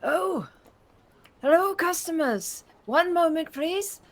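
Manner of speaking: put-on a cheerful elderly Dragonborn shopkeeper sings out a greeting; old shopkeeper voice. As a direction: shopkeeper calls out to customers entering the shop. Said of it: "the familiar voice".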